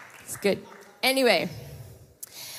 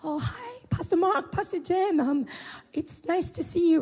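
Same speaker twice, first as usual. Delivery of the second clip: put-on young girl's voice; 9 semitones higher than usual. Voicing the young girl.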